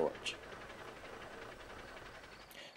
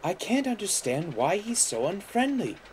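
high, troubled engine voice; frowning puzzlement; 9 semitones higher than usual. A narrator's reading. Mavis wondering about George's rudeness, frowning puzzlement. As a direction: pitch it up, puzzled. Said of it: "frowned Mavis".

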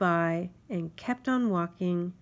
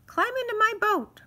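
high, louder little-insect voice, officious; fussy mosquito; 6 dB louder than usual. Acted. Mosquito insists on the ferry rule, high and officious. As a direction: lay down the law like a tiny self-important official.

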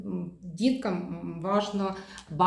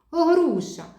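bright answer; 9 semitones higher, 5 dB louder than usual. Shown as a demonstration. Child answering a question.

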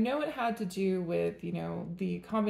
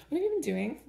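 higher, teasing sing-song; playful teasing; 7 semitones higher, faster than usual. Acted. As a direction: tease them like a mischievous kid. Teasing a zodiac sign.

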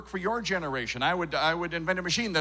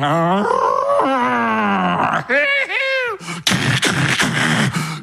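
long, loud cartoon Texan cackle; Texan whooping laugh; 4 semitones higher, 6 dB louder than usual. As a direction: let out a drawn-out, shouted Texan hoot.

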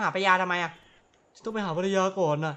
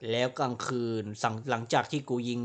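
recap narration; 6 semitones lower, 4 dB quieter than usual. Acted. Gamer recaps his crimes to viewers, low deadpan recap. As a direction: lower and softer, deadpan summary.

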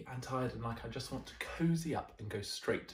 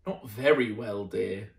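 lower, yawny weary answer; yawning reply; 3 semitones lower, 7 dB louder than usual. Acted. Mister Bear answering his wife, lower yawny reply. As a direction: yawn through the words. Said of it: "yawned Mister Bear".

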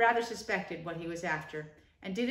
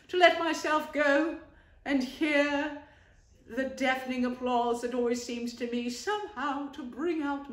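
high, loud, hammed-up lament; self-pitying Toad; 5 semitones higher, 4 dB louder than usual. Toad grandly mourns his lost banquet, theatrical. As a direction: wail it like a wounded tragedian.

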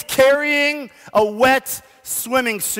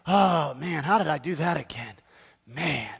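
softer, lower, self-pitying sigh of a sinner; mock regretful mutter; 7 semitones lower, 7 dB quieter than usual. Preacher voicing a sinner who fell again, softly rueful. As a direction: groan it like you slipped up again.

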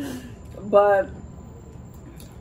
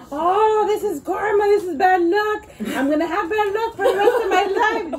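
imitating a freaked-out friend; freaking out; 9 semitones higher than usual. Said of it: "she started freaking out".

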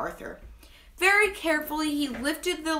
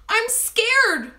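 high, frightened little voice; lightly acted whimper, pitched up; 10 semitones higher, 5 dB louder than usual. A narrator's reading. Henry stuck in the dark pit, acted whimper. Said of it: "Henry whimpered".

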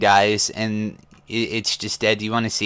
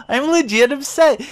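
high, hammed-up sad pleading; mock whimper; 11 semitones higher, 4 dB louder than usual. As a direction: whine it way up high like a heartbroken kid. Mock distress over Yoshi.

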